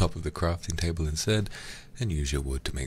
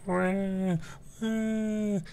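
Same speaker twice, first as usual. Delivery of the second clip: tiny squeaky squid wails, much higher; little squid people; much slower than usual. Retold or imitated. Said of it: "squid people who are like".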